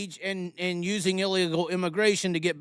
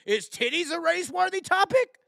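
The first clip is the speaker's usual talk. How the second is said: mocking high question; 10 semitones higher than usual. Mock question to commenters.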